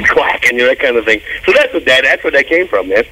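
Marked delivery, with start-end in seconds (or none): none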